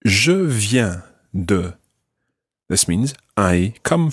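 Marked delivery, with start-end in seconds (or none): 0.0-1.8 slow, separated "Je viens de"; slow model phrase. Shown as a demonstration. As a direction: draw out "Je viens de" word by word for a learner. Teacher models phrase slowly for learner.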